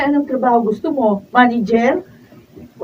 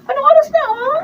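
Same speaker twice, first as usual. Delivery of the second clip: high, loud, fast scolding; wife berating her lazy husband, high and loud; nagging scold; 11 semitones higher, 7 dB louder than usual. Acted. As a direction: tear into him for being late.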